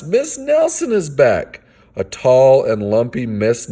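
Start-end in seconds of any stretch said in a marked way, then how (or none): 0.0-1.6 high delighted announcement; relieved class. A narrator's reading. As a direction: cheer her return. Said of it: "said the class".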